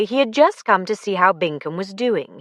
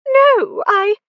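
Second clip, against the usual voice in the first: high, loud startled cry; startled protest; 4 dB louder than usual. Acted. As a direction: blurt it out, cut off. Lucy protesting, cut off.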